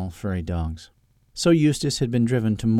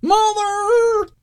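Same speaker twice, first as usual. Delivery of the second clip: very high, loud terrified cry; cry of terror; 7 dB louder than usual. A narrator's reading. Eustace sees an explosion at the back, crying out in terror. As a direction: shriek it out in terror. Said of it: "cried Eustace in terror".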